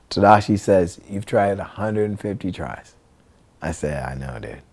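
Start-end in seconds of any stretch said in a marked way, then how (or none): 1.1-3.0 softer, quicker "hundred and fifty tries"; understated remark. Retold or imitated. Friend points out the failed attempts, understated remark. 4.0-4.6 very low, soft admission; resigned mutter. Retold or imitated. Skater admits to friend the tries are failing, resigned mutter.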